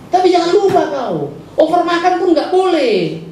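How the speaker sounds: high, slow, emphatic warning; preacher warning the congregation about overeating, high and slow; pointed warning. Acted. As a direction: lean hard on the point.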